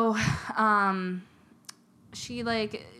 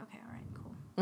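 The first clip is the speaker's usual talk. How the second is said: relieved little whisper; 7 dB quieter than usual. Retold or imitated. Scared lady whispers to herself in the dark. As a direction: whisper to yourself, calmed. Lady reassured by dog lick.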